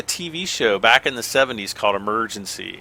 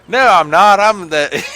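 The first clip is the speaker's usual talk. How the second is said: louder, higher mock country accent; faking a country accent; 8 semitones higher, 10 dB louder than usual. City boy trying to fit in with farm family, mock country accent. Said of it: "try to, have a country accent".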